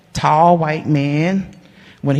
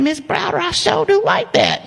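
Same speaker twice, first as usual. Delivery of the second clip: high, sing-song approval; a hint of a delighted old man; lightly voiced charmed owner; 14 semitones higher than usual.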